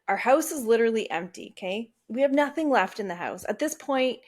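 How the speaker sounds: ordinary talk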